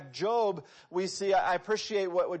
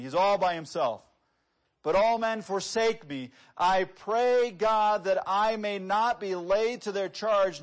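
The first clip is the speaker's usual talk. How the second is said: louder, preached reading of the psalm; preacher reading the Bible aloud with fervour; fervent scripture reading; 4 semitones higher, 6 dB louder than usual. Acted. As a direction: proclaim the verses with full force. Reading Paul's words with fervour.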